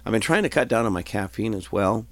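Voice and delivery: normal talking voice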